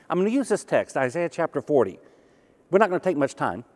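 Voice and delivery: usual speaking voice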